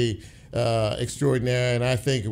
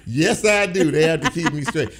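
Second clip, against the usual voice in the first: excited; 4 semitones higher, 5 dB louder than usual. Retold or imitated. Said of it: "I said".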